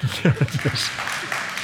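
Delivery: breathy, voiceless chuckle of delight; quiet joyful chuckle; soft laugh. Real, not acted. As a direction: laugh softly at the good news. Delighted at his healed arm.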